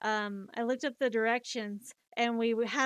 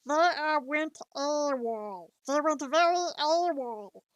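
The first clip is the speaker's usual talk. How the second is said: slow, drawn-out cartoon alien voice; Stitch; 4 semitones higher, slower than usual. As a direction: stretch the words like a little alien creature. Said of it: "Stitch, I don't know if you're".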